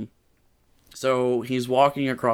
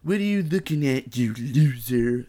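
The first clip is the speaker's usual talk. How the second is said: snarled Trump line. Acted. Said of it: "I did that quote wrong".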